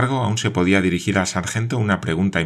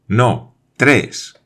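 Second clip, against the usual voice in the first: higher, louder No, tres; a pressed correction; correcting; 5 semitones higher, 6 dB louder than usual. A narrator's reading. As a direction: cut in to correct him. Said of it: "dijo Glenarvan".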